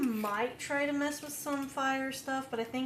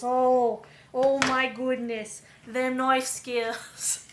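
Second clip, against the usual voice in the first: Australian wildlife narration, getting louder; Australian documentary narrator; 5 dB louder than usual. Acted. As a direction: narrate a cook like rare wildlife, building to loud awe. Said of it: "I thought I was watching a Nat Geo documentary".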